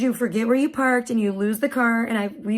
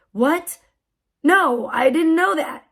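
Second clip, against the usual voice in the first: higher, louder drawn-out astonishment; surprised; 5 semitones higher, 4 dB louder, slower than usual. Retold or imitated. Mom learning of free parking, slow surprise. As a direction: act pleasantly stunned by the news.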